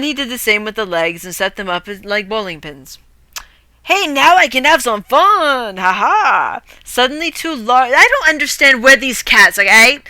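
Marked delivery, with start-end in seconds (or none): 3.8-6.6 gleeful hero. Acted. Hero's gleeful line in story.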